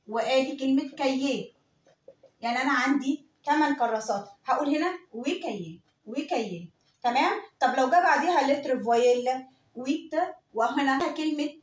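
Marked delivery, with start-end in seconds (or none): 5.1-6.7 "وكيي" with no t before the k sound; silent t. Shown as a demonstration. Teacher showing eight notebooks in French, silent t. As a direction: drop the t of huit, straight into "كيي". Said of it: "ساكن الت ما بنطقهاش".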